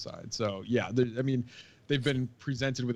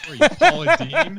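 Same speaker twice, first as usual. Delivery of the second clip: laughing; 16 dB louder than usual. Real, not acted. Teasing Paula Deen voice.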